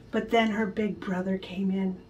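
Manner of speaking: ordinary delivery, nothing marked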